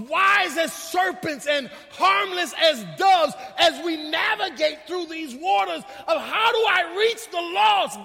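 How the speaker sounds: high, loud, drawn-out sermon cry; full-force preaching. Real, not acted. Preacher urging the church to reach the lost, full-force preaching. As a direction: preach it out with rising fervor.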